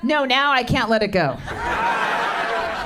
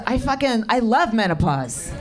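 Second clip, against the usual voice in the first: normal talking voice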